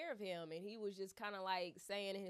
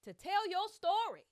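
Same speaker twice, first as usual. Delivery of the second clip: rousing push; 11 semitones higher, 9 dB louder than usual. Retold or imitated. Therapist urging patient on.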